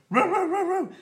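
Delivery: high, loud barking woof; mock dog bark. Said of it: "Lassie would just run home and go".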